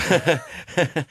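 a quick sheepish chuckle; brief self-mocking laugh; short embarrassed laugh. Real, not acted. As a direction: laugh off the admission. Embarrassed laugh at own failing.

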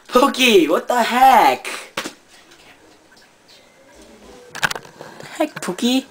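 high squeaky voice scolding the dog; high-pitched fake outrage; high mock scolding.

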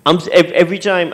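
ordinary talk

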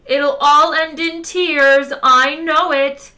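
high, slow wailing shout. A narrator's reading. Computer calling after the departing crew, shouted. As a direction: call it out loud and long, pitched high. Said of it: "shouted Eddie after them".

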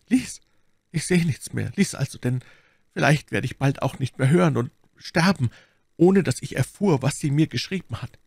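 high, desperate deathbed voice; dying duke, pleading. A narrator's reading. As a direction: beg with failing strength, voice pitched high. Dying duke begs for letter. (sterbender Herzog, flehend)

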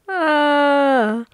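loud, high, drawn-out aah; exasperated cry. Real, not acted. Player recoiling from lewd messages, long loud high cry.

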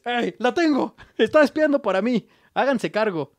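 gloating German officer, hammed-up; mock triumphant shout. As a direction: call it out like a smug spy boss. Spy betrays agent, voicing German.

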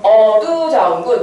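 어두자움군 stretched out at half speed; sounding out slowly. Shown as a demonstration. Teacher has students read the term aloud, sounding it out slowly. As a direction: read 어두자움군 slowly, drawing out the first syllables. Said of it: "소리 내보세요".

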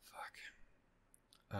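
breathy whispered fuck, under the breath; whispered curse. Real, not acted. Reactor dreading the next episode, whispered curse. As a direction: let it slip out as a despairing whisper.

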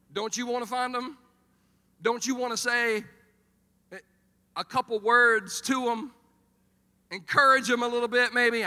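rousing, shouted challenge; impassioned appeal. Real, not acted. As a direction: press the congregation at full volume.

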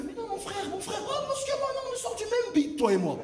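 high, lightly voiced pal; hammed-up friendly tough; mock buddy voice. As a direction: play the overfriendly big guy. Imitating smooth-talking big guy.

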